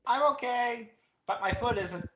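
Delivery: high, strained little voice; squeaky hurt voice. Retold or imitated. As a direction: pipe up bravely despite pain. Injured character voiced.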